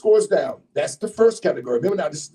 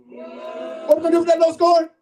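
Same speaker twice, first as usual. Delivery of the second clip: hyped shout; 10 semitones higher than usual. Real, not acted. MC firing up the crowd before a poetry slam, hyped shout. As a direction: whip the audience up. MC repeats hype louder.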